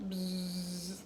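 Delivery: long imitated bee buzz; drawn-out "bzzz" noise. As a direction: buzz like a big bee approaching. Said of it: "I could hear it coming from a".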